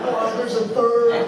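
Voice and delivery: a hint of a kid discovering; mock surprise. Mimicking kid's surprise.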